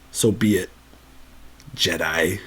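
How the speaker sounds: low, soft, menacing Emperor whisper; sinister Emperor. Retold or imitated. Palpatine dismisses the defiant Luke, low menacing whisper. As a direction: hiss it like a cold villain. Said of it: "Palp's not impressed".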